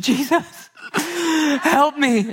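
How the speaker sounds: high, mock-sobbing plea to Jesus; hammed-up wail, voice cracking; dramatic cry for help. Boy shows his mom the wound, high sobbing plea. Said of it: "I said".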